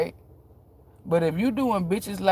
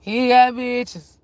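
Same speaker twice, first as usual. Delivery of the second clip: slow, higher, louder HE GOT BITCHES; emphatic repeat; 6 semitones higher, 5 dB louder, much slower than usual. Real, not acted.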